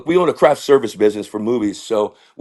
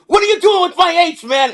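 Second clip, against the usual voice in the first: very high-pitched outraged director; mock-indignant screech; Tim Burton. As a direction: scold the culprit in a high comic voice. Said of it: "he goes".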